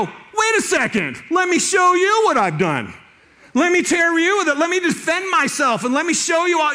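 high, loud defensive outburst; mock indignant shout. Pastor acting the self-defence he didn't do, loud and high.

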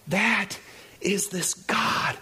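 whispered and slow. Real, not acted. Pastor hushing the church for the point, whispered. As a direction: breathe it out, hushed.

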